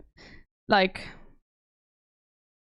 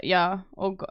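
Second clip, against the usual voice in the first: normal talking voice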